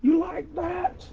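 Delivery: Kirk Cousins catchphrase. Retold or imitated. Breaker quoting the Kirk Cousins celebration, slow high squeal. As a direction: stretch it out like a celebrating quarterback. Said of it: "I pull a Ca-, uh, Kirk Cousins".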